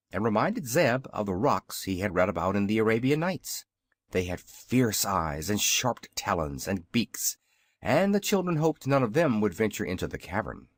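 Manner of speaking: ordinary delivery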